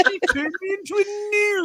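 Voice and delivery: hammed-up Python peasant; high mock villager. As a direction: whine it like an aggrieved villager. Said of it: "quoting Holy Grail villager".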